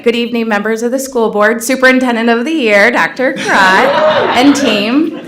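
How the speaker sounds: loud, projecting address voice; principal voice. Shown as a demonstration. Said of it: "use my principal voice".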